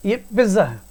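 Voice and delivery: पिज्जा said "piz-za" with no t; wrong pronunciation. Shown as a demonstration. Teacher showing students the wrong way, mispronounced. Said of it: "जबकि pronunciation गलत है".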